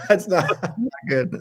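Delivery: real laughter breaking up the words; laughing. Real, not acted. Host teased about his French accent, laughing.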